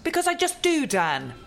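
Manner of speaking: loud, high exasperated outburst; angry snap. Acted. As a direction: lose patience and shout. Press officer snaps at manager.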